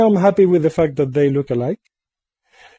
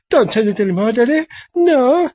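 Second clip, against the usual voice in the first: whiny son voice; 7 semitones higher than usual. Pretend. Son fish pleads with father.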